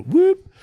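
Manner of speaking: quick rising imitated siren blip; brief high whooped siren noise; police siren whoop. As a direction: mimic a short squad-car siren, sliding up high. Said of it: "hit their siren".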